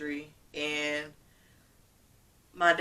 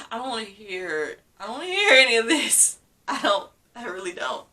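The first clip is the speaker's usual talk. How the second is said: rising, louder refusal, quickening; upset protest; 5 semitones higher, 5 dB louder than usual. Retold or imitated. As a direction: push back, getting upset and louder. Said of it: "it was just like".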